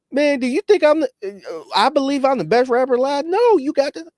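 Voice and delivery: high, pleading voice of a rapper doubting himself; squeaky mock modesty; mock humble rapper. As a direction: ham up a timid rapper asking for approval. Mocking a humble rapper on mic.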